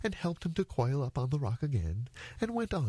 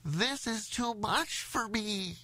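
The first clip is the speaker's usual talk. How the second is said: slow, high nasal wail; through his nose; 12 semitones higher, slower than usual. Acted. Elephant's child losing the tug-of-war, high nasal voice. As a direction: wail it with the nose pinched shut. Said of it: "he said through his nose".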